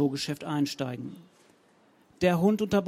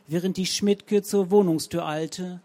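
usual speaking voice

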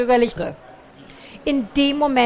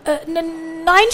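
stotternd; 6 semitones higher, 5 dB louder, slower than usual. Acted. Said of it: "stotterte Pikandu".

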